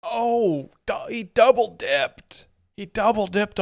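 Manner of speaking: high, drawn-out gleeful reaction; crowing over a joke that just landed; excited reaction. Real, not acted. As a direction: pitch up high, stretch the key words. Reacting to a comedian's punchline.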